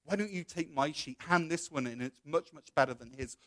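soft, furtive persuasion; hushed sneaky sales pitch; tempter's whisper. Acted. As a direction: coax them under your breath. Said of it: "Satan starts whispering".

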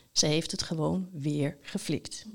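low, hushed, almost whispered; quiet marvelling. A narrator's reading. Knight thinks fondly of Lampje, quiet marvelling. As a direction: murmur it to yourself in wonder.

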